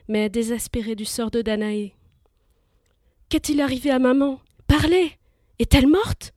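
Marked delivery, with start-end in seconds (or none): 3.3-6.3 loud, higher desperate cry; frantic shouting. Acted. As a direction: demand an answer in panic. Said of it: "cria Persée".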